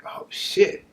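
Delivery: hushed oh shit, voice dropped away; blinded by police flashlights, cursing in a whisper; whispered curse. Retold or imitated. Flashlights in his face.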